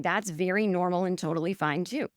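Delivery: ordinary talk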